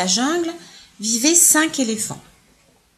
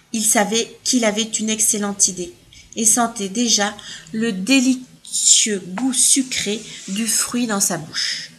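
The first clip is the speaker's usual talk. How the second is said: plain reading voice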